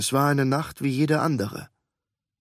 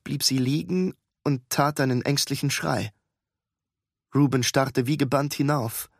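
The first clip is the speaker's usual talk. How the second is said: plain reading voice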